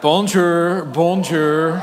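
loud, hammed-up French bonjour; mock French greeting. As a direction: greet them like a cheesy Frenchman. Playful French greeting to congregation.

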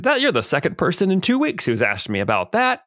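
put-on a puzzled old scholar; professor voice. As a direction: Professor Ironspark tells the players. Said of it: "he said".

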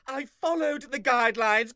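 usual speaking voice

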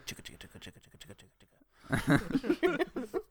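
whispered train chug; chugging toy train voice. Retold or imitated. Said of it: "just in the cinema going".

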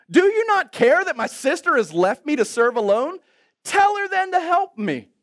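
hammed-up indignant appeal to the Lord; fed-up Martha. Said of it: "Martha, now she's had enough".

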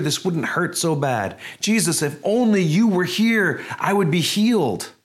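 a hurting believer's plea to Jesus, building to a cry; desperate plea. Pretend. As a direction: pour out an aching prayer that builds to a cry.

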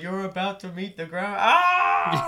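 rising into a loud mock yell; mock falling scream. Fan acting out Thor's fall with a hammed-up yell. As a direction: fake a plummeting cry.

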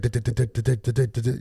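rapid-fire stuttered thumps; pounding heartbeat. Retold or imitated. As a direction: rattle out a racing heart. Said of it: "my, my chest is like".

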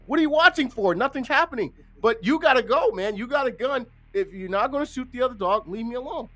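retold exasperation, pitched high and pressing; exasperated retort. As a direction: argue back at the officer, high and insistent. Retold argument with police officer.

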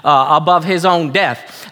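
ordinary talk

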